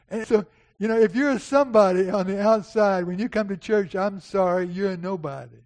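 loud, high preaching. Acted. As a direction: declare it to the church. Preaching at full force.

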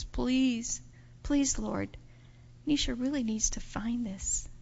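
soft, earnest plea; hushed pleading prayer. Acted. Host praying with a girl before searching, hushed pleading. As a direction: pray softly for a child in need. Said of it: "and we asked Jesus".

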